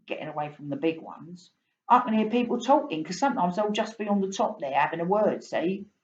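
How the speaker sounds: a chatty cockney fish from the Thames; London fish explaining his trouble to the boy. Acted. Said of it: "You can tell I'm from London".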